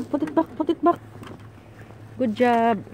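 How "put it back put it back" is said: high coaxing repeat; sing-song coax to put it back; squeaky praising a dog. Real, not acted. Coaxing child again.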